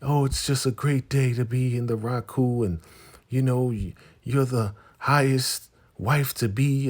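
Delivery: gushing high and loud, all fake cheer; fake sweet gushing. Two wives faking delight for the husband, sugary gush. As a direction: put on a syrupy fake-happy voice. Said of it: "they're both acting like".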